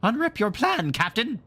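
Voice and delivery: high, eager pirate cry. A narrator's reading. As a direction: beg the captain for the plan, thrilled. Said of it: "he cried eagerly".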